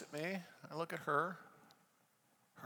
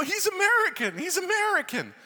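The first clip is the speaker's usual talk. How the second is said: high, loud, amazed exclaiming; excited students; 14 semitones higher, 7 dB louder than usual. Retold or imitated. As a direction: imitate an excited crowd spreading the news. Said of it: "They're all getting excited".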